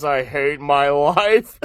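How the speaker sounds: lightly acted, slow and low; a hint of glum, drawn-out delivery; Ed's catchphrase. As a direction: drag it out as a mild dejected catchphrase. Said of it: "saying his, uh, catchphrase".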